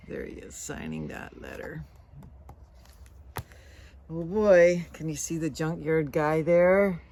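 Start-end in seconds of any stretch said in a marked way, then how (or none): none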